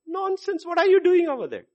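high, scolding disbelief; exasperated God. Retold or imitated. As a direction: scold him for lying there. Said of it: "God would have said".

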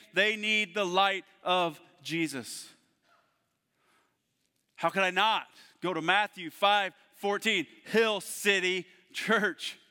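loud, rising preacher's build with hammered repeats; full-force preaching. Acted. Pastor rallying the church, preaching at full force.

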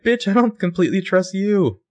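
high, loud outburst of disbelief; mock indignation. [name]'s imagined retort to her distrustful boyfriend, mock indignant.

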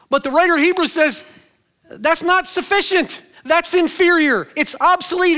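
high, loud, driving preacher voice; full-force preaching. Acted. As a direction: hammer it home from the pulpit. Preacher climaxes on inferior priesthood.

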